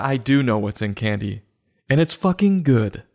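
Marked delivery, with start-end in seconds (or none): none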